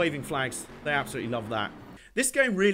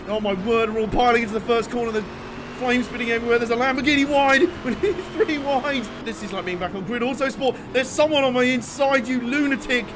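excited; 6 semitones higher, 5 dB louder than usual. Real, not acted. Frantic first corner, live commentary.

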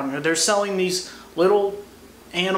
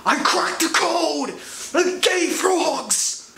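much higher, manic conspiracy-host shout, slow on the last line; hammed-up triumphant conspiracy ranter; Alex Jones; 10 semitones higher, slower than usual. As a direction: rant like a talk-show host who just cracked it. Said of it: "I felt like Alex Jones".

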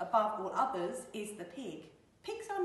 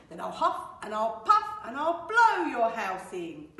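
loud, booming wolf threat; big bad wolf bellowing; wolf's threat; 10 dB louder than usual. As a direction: huff it out at full volume. Wolf threatens the pig again.

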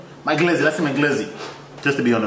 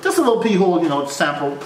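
ordinary delivery

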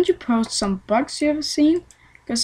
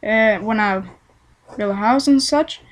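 usual speaking voice

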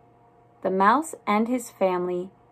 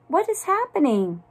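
higher-pitched storybook character asking; puzzled question; 7 semitones higher than usual. A narrator's reading. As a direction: ask it high, wanting to know. Puzzled character asks what's happening.

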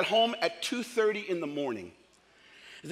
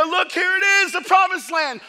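loud proclamation; 8 semitones higher, 7 dB louder than usual. Retold or imitated. God showing the promised land.

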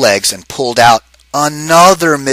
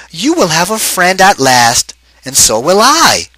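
happily; 7 semitones higher, 4 dB louder than usual. Acted. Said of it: "she added happily".

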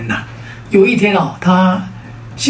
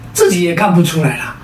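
usual speaking voice

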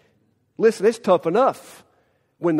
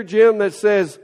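ordinary talk, nothing marked

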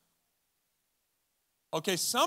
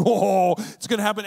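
mock excited teasing; 10 dB louder than usual.

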